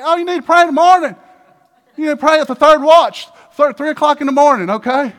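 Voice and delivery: high, loud insistent advice; bossy church adviser shouting orders; mock preachy shout. Church adviser lecturing the seeker, mock loud.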